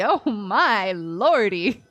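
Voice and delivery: ordinary talk, nothing marked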